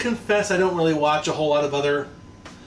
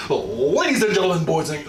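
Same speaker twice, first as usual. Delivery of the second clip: ringmaster; 4 dB louder than usual. Shown as a demonstration. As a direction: announce it like a wrestling ring announcer. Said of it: "oh, no, that's not it".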